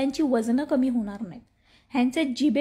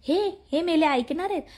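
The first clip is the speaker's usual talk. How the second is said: normal talking voice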